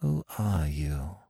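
soft, slow, hushed question; low voice. A narrator's reading. Captive woman questioning Smith, low voice. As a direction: ask it slowly under your voice. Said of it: "in a low voice".

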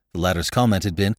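ordinary delivery, nothing marked

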